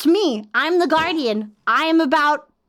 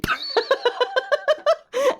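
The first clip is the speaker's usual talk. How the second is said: high gleeful villain laugh; evil laugh; 11 semitones higher than usual. Acted. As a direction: cackle like a villain. Villain laugh after destroying planet.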